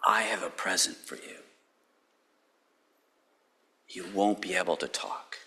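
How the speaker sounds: low, soft, dry announcement; wry soft gift. Retold or imitated. Gabriel telling Zechariah of his silence, low and soft. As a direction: hand over the gift quietly, with irony. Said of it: "Gabriel said".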